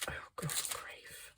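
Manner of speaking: breathy whisper of exasperation under the breath. Real, not acted. Muttering to herself while crafting.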